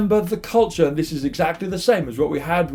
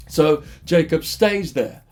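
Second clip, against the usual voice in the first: ordinary delivery, nothing marked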